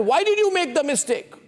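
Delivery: high-pitched accusing question; sharp, emphatic reproach; laying blame. Acted. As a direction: pin the mistake on the other person. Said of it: "already laying the blame".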